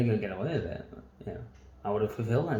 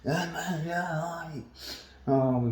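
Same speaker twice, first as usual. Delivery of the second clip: high-pitched druggy rambling voice; mock spaced-out festival drunk; off-his-trolley lad; 7 semitones higher, slower than usual. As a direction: imitate a wasted lad, pitched well up. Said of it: "he was off his trolley".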